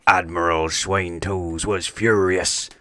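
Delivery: usual speaking voice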